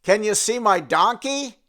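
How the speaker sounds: loud call up from the tree. Retold or imitated. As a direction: shout it up to the branches. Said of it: "he called out and said".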